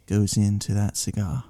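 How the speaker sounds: measured, halting mock British accent. Cigar reviewer describing subtle notes, calm British accent. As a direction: pause over each note like a British connoisseur. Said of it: "you sounded just like [name]".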